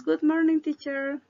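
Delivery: students greeting teacher. Retold or imitated.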